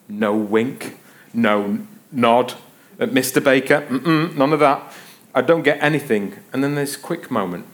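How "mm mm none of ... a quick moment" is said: ordinary talk, nothing marked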